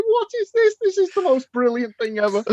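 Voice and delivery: high-pitched gleeful disbelief; amazed delight. Retold or imitated. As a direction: retell being utterly thrilled and astonished. Recounting amazed delight at the game.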